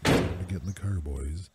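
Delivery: very low, soft, slow put-on voice; deep voice demo. Shown as a demonstration. Showing the episode he could do in a low voice. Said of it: "What if we did a whole episode like this".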